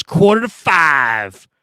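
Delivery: coach's voice. Retold or imitated. Coach deadpan answer.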